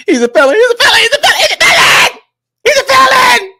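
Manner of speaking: high mocking whiny wail; mocking her crying. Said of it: "Then she started saying".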